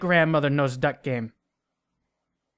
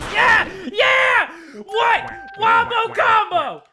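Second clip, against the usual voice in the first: very high, loud triumphant shouts; hype yelling; 14 semitones higher, 4 dB louder, slower than usual. Real, not acted. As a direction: scream the win at the screen. Hype celebration.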